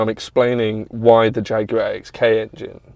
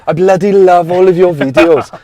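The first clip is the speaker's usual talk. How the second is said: loud, high Welsh impression; gushing Welsh fan, hammed up; Welsh accent; 7 semitones higher, 8 dB louder than usual. Welsh racer praising the speaker's videos, in a loud Welsh accent. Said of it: "That is a good Welsh accent".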